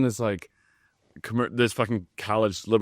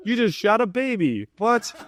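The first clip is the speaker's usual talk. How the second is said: high, drawn-out incredulous protest; appalled disbelief; 9 semitones higher, slower than usual. Retold or imitated. Appalled sponsor reacting to the films they sent, incredulous. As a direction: stretch it out high, utterly baffled.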